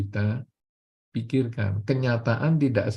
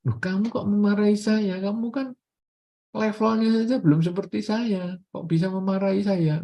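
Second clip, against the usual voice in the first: high, miffed and offended; indignant retort; 6 semitones higher than usual. Acted. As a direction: act wounded pride, higher pitch.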